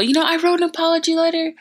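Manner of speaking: high, rushed pleading; retold excuse. Student telling the principal about the apology, rushed high pleading. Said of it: "I tell the principal".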